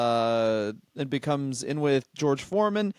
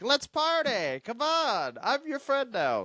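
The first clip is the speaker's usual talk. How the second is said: mock Don King; 10 semitones higher, slower than usual. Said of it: "'cause he was like".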